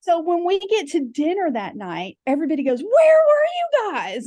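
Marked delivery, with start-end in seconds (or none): 2.8-4.2 high, slow, drawn-out mock question; high stretched-out group call. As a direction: squeal it like the whole group is puzzled. Friends calling out at dinner.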